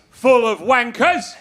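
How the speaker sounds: loud, high, slow mocking jeer; heckler in the crowd. Crowd heckler jeering at the Manchester comic. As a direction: bellow it like a rowdy heckler. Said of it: "Guy in the crowd goes".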